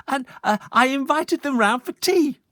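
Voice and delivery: high, bright-eyed eager mum; mother's voice. Acted. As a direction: gush it like a proud mother.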